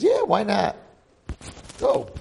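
high, squeaky voiced girlfriend; storyteller mimicking the girlfriend; mock girl voice. Girl urging him to go dance.

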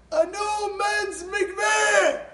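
very high, drawn-out shout; stretched triumphant call; grand announcement. Retold or imitated. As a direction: proclaim it slowly to the whole congregation. Donor announces gift to whole congregation.

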